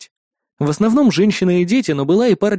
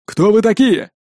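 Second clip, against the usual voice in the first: guard voice; 5 semitones higher than usual. A narrator's reading. Guard calls from the wall.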